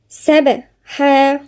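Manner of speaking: سبحا with a bounced, echoing ب; slow سبحا with a clear qalqala; tajweed demo of سبحا. Shown as a demonstration. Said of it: "في قلقلة عند الباء".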